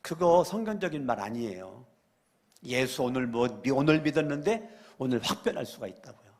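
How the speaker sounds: ordinary talk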